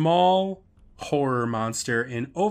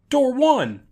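dad's high, loud shout; retold yell; 13 semitones higher, 6 dB louder than usual. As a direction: yell it out as the panicking dad. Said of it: "Dad eventually yells out".

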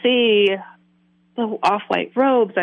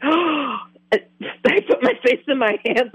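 gasping; 5 semitones higher, much slower than usual. Retold or imitated. Overwhelmed by recognition.

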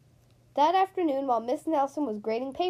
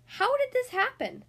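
amazed question; 11 semitones higher than usual. Acted. Coach amazed at team.

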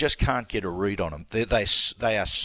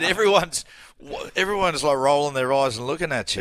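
high, loud, exasperated son voice, a lightly voiced teen; embarrassed teen telling off his dad; teenage son scolding; 5 semitones higher, 4 dB louder than usual. Son scolding his singing dad at the footy, high and exasperated.

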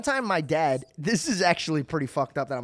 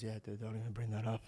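lower, much softer embarrassed dad; lightly voiced dad; 7 semitones lower, 14 dB quieter than usual. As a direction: brush it off like a sheepish father. Imitating dad brushing off memory.

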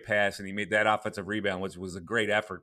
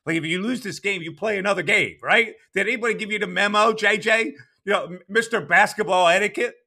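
loud, high mocking retort; incredulous rant; 10 semitones higher, 6 dB louder than usual. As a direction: needle him like an annoyed fan. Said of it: "But I go".

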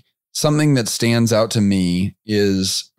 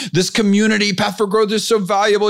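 much higher, gushing and amazed; excited member quote; 10 semitones higher than usual. Retold or imitated. Retelling a member's voice memo to the host, high excited gush. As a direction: gush like a thrilled young entrepreneur. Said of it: "I wish I could do his accent, but I can't do his accent".

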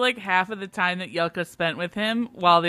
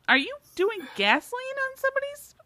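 mock disbelief, pitched way up; hammed-up astonished squeal; high shocked squeal. Imagined Helen shocked.

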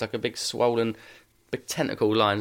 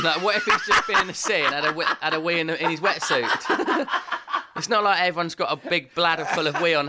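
laughing through the words; giggling while telling it; 4 semitones higher than usual. Real, not acted. Joking about peeing in wetsuit.

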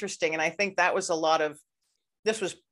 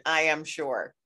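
ordinary talk, nothing marked

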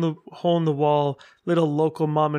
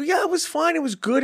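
high gushing reply; 12 semitones higher than usual. Retold or imitated. Gushing again to uncle.

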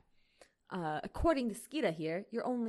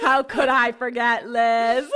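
laughing; 13 dB louder, slower than usual. Real, not acted.

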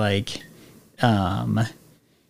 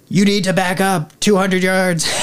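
mock loudspeaker announcement, pitched up; loudspeaker order; 7 semitones higher than usual. Gunboat crew warns a fishing dad and child, loudspeaker voice. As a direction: imitate an official calling over a loudspeaker. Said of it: "they get on a loudspeaker".

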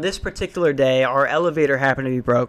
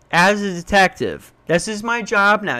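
ordinary talk

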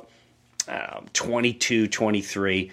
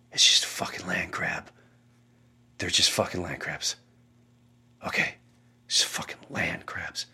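softer, unvoiced whisper; 7 semitones lower, 8 dB quieter than usual. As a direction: tell yourself it's only crabs, hushed. Dreaming father whispers.

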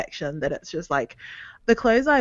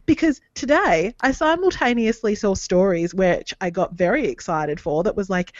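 normal talking voice

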